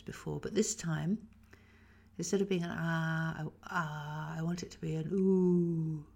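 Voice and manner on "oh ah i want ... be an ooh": usual speaking voice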